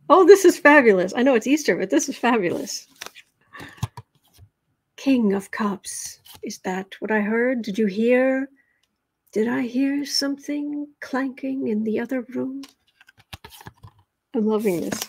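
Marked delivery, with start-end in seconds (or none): 0.1-1.1 loud delighted exclamation; excited. Real, not acted. Excited reaction to card. 5.0-12.6 lightly spooky whispered host; hushed, teasing ghost-story voice; horror host. Acted.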